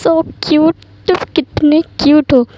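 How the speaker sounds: lightly voiced cooing over him; adoring tease. Wing coos fondly at shy Lan Zhan, teasing.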